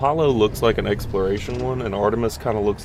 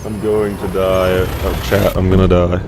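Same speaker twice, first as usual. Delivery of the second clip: panicking gamer; 7 dB louder than usual. Pretend. Allergy fit, hammed-up despair.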